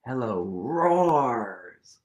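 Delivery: plain reading voice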